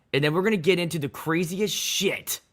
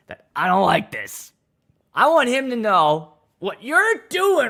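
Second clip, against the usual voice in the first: indignant complainer, raised pitch with loud bursts; mock angry guest; 5 semitones higher, 4 dB louder than usual. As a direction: imitate an outraged guest, hitting words hard. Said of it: "that get mad".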